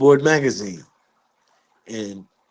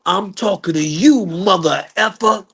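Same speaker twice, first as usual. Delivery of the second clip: higher-pitched angry comeback; fearless defiance; 6 semitones higher than usual. Retold or imitated. Joe Jackson facing down the father, fearless and angry. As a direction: imitate a man standing his ground. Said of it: "got up without an ounce of fear".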